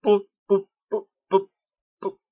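stammering through numb lips. A narrator's reading. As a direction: lips won't obey, mumble it. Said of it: "промычала она непослушными губами".

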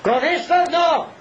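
high, slow, forceful refusal; powerful, high-pitched proclamation; strong, powerful voice. Retold or imitated. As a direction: cry it out like a priest refusing from the pulpit. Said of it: "con voz fuerte y potente".

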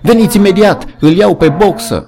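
high, loud desperate call; alarmed shout. A narrator's reading. Benjamin calling the animals to come, shouting in alarm. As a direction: yell the warning across the field. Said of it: "țipă el".

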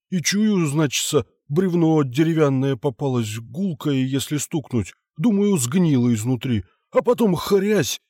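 a high, excited peasant voice; rustic foreman voice. Acted. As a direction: tell it like a simple scared workman. Foreman figure tells story.

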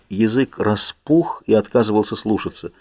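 plain reading voice

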